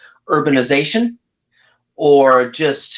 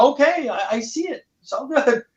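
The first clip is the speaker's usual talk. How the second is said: mock reassuring agreement; 12 semitones higher than usual. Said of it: "I'm like".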